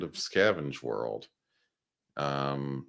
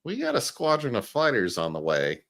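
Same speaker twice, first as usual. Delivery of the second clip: much higher, doubtful questioning tone; skeptical officer voice; 7 semitones higher than usual. Retold or imitated. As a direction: needle him with a doubting question. Acting out the officers' reply.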